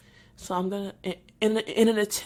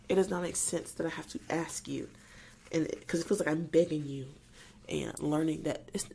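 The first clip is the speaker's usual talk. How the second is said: ordinary talk, nothing marked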